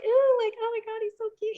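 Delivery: high swooning fangirl; hammed-up gushing crush. At ringside to Batista, high hammed-up swooning. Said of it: "I'm hamming it up to him".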